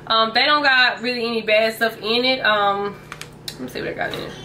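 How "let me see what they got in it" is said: whispered aside while reading. Real, not acted. Vlogger reading the pouch label, whispered. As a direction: breathe it under your voice.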